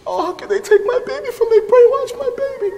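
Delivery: high, wailing mock lament of a mother; imitating crying mother. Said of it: "and she was crying".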